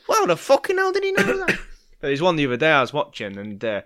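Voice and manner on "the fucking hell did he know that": much higher and louder, hammed-up disbelief; over-the-top astonished sitter; mock amazed shriek. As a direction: shriek it like a gobsmacked old woman. Imitating amazed sitter at reading.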